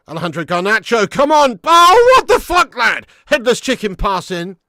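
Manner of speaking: loud, high outraged shout at the screen; furious fan yell. Real, not acted. Fan roaring at his team on a live watchalong. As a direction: yell in disbelief at a wasted attack. Fan yells at bad pass.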